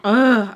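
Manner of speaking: loud groan of disgust; exasperated groan. Real, not acted. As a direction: burst out with a frustrated "ugh". Disgust at the horrible outcome.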